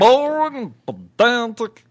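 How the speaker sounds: high, slow, drawn-out put-on voice; hammed-up sneering drone; mock pompous drawl. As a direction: stretch each word like a snooty critic. Echoing in a put-on voice.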